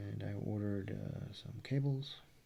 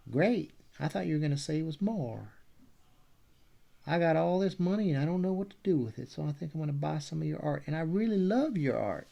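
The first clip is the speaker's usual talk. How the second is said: loud, high-pitched, hammed-up Texan; Middle Texas accent; 6 semitones higher, 9 dB louder than usual. Imagined rich fan buys the art, loud Texas accent. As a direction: gush loudly like a Texan flush with money. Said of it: "he's, uh, he's really from Middle Texas".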